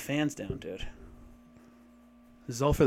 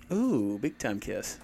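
oily smooth seducer; 4 semitones lower, 6 dB quieter, slower than usual. Acted. Mock sultry reply to kiss.

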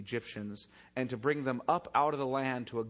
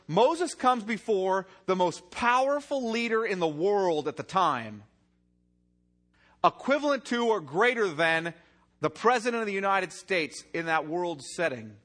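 loud, driving sermon delivery; forceful preaching; 5 semitones higher, 6 dB louder than usual. Acted. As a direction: preach it hard to the congregation. Preacher builds to forceful climax.

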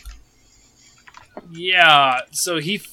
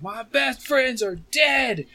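high, slow grieving outcry; a traumatized survivor yelling his grief at the party; anguished shout; 10 semitones higher, slower than usual. Acted. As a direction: cry out that your friends are dead. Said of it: "he's like".

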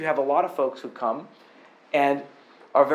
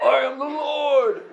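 higher, slow hammed-up macho proclamation; macho Jesus voice; 9 semitones higher, 4 dB louder, slower than usual. Preacher mocks macho Rambo Jesus, hammed-up proclamation. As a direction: boom it like an action-hero Jesus.

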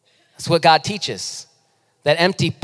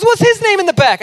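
Calvin asking; 13 semitones higher, 4 dB louder than usual. Retold or imitated. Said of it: "He goes".

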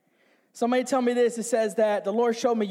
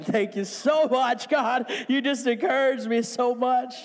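drawn-out, sarcastic thanks; hammed-up appreciation; mock gratitude; 4 semitones higher than usual. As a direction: overdo the thanks, dragging it out. Mock thanks after deflating reply.

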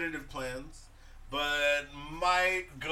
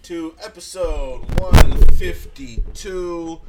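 announcer intro; 2 semitones higher, 20 dB louder, much slower than usual. Acted. Podcast intro, drawn out.

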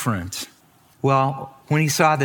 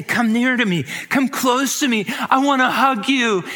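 calling out warmly; 10 semitones higher than usual. Acted. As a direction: call them in like a brother who longs to embrace. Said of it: "he's calling his brothers".

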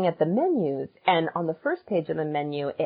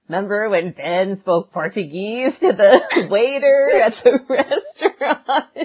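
laughing; 3 semitones higher, 5 dB louder than usual. Retold or imitated. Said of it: "be like".

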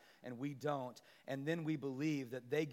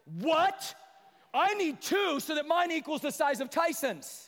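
put-on high, loud childish outrage; indignant kid; 12 semitones higher, 10 dB louder, slower than usual. A jealous sibling demands two cookies, high and loud.

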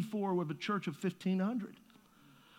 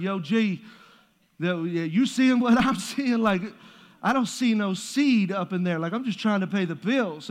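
louder, loose street-slang talk to God; 4 dB louder than usual. Retold or imitated. Pastor jokingly complaining to God about empty bank account, in street slang. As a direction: slip into street slang like talking to a buddy.

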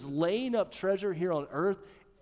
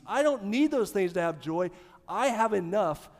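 loud, rising preacher cadence; forceful preaching; 4 semitones higher, 5 dB louder than usual. Acted. Pastor urging the congregation to say enough, forceful preaching. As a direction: drive it home to the congregation.

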